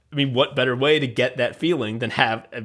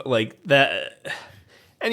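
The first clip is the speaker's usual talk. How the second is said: ordinary talk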